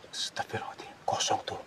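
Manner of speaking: very soft whisper; whispered prayer. Real, not acted. Ghost hunter whispers that it's empty, unnerved whisper. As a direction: hush it, unnerved.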